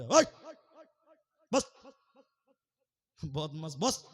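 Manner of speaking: short, loud orders to sit; mock-angry preacher halting a runaway; acted scolding.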